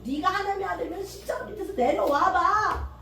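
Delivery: a hint of a jeering bystander; mocking taunt. Passer-by mocking Jesus on the cross, sneering. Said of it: "또 조롱하고".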